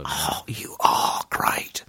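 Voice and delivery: low, drawn-out whispery croak; movie line imitation. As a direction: quote the film in a slow, voiceless rasp. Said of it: "Do you remember that line from the movie".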